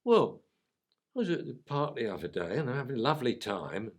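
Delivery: put-on breezy puppy in a London accent. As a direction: rattle it off like an eager London pup. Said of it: "use a Cockney accent".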